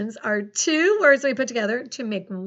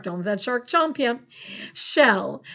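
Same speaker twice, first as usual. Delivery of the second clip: ordinary talk, nothing marked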